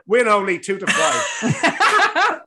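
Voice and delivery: slow, laughing through the words. Real, not acted. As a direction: chuckle out each word slowly. Laughs at his own nap.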